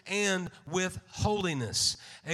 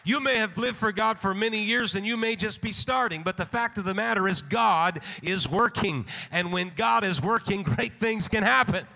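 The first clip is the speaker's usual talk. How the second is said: louder, forceful sermon delivery; rousing preaching; 6 dB louder than usual. Real, not acted. Preacher urging the congregation that God is working, rousing preaching. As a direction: preach it out loud with building conviction.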